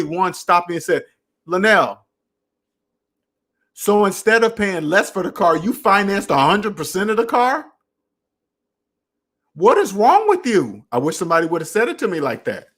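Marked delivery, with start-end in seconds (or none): 1.4-10.9 pitched higher, slowing on the last question; imagined friend, half-serious disbelief; mock scolding. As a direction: playfully scold a friend who financed everything. Imagined friend scolding his finance choice.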